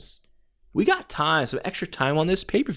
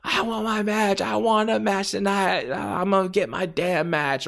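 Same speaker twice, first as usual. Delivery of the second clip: higher voice, wild demanding rant; demanding Terry Funk; 7 semitones higher than usual. Retold or imitated. Wrestler barging in demanding a match, wild rant. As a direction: shout your demand like a crazed wrestler. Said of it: "seeing Terry Funk come down".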